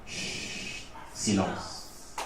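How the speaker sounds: long shush and a barely voiced, low word; shush, then almost vanish; hushing. A narrator's reading. Narrator on the silent son, hushed.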